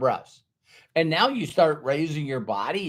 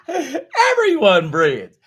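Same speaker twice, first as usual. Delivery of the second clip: slow, higher, laughing through it; 10 semitones higher, 5 dB louder, much slower than usual. Real, not acted. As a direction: laugh the words out.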